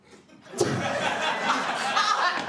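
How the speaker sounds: high loud burst of laughter; howling laugh; big laugh. Real, not acted. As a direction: cackle at the answer. Crowd work, big laugh.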